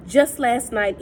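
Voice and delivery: ordinary delivery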